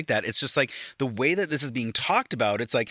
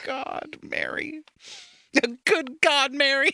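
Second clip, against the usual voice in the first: mock dramatic; 14 semitones higher, slower than usual. Mock exclamation.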